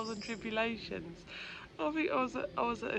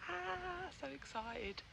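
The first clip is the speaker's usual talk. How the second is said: mock excited; 9 semitones higher, 4 dB quieter, slower than usual.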